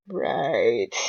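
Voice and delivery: very low, gruff one word; grudging deep agreement. Acted. Reaper forced to agree with McCree, very low gruff right. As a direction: sound like Reaper, dragged into it.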